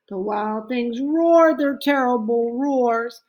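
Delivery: plain reading voice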